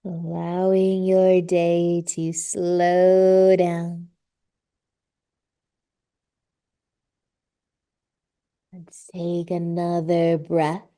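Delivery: slow, drawn-out yoga teacher voice; guided yoga relaxation. Real, not acted. Yoga teacher settling a class into child's pose, calm guided relaxation. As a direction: ease them into rest with long, lingering words.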